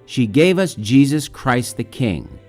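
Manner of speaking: usual speaking voice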